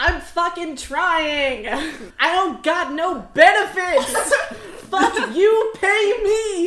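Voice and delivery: high, loud, slow exasperated yelling with laughter; exasperated shout. Acted. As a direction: messenger hollering back while fleeing. Messenger shouts back, exasperated.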